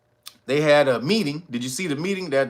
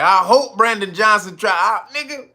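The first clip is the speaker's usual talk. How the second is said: 1 gloating dare; 6 semitones higher, 4 dB louder than usual. Retold or imitated. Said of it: "he was like".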